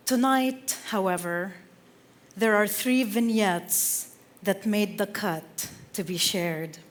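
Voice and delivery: ordinary delivery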